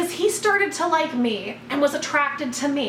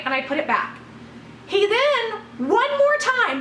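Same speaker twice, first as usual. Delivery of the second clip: usual speaking voice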